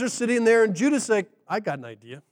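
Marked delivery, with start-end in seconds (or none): 1.5-2.2 low, hushed scheming voice; quietly pitched idea, acted out; sly aside. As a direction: drop it low and soft, like floating a scheme. Judah's scheming suggestion to brothers.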